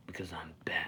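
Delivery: whisper. Pretend. Joking whispered Batman catchphrase.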